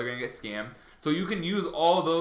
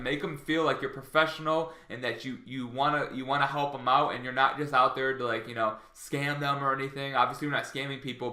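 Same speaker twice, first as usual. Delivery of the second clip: ordinary talk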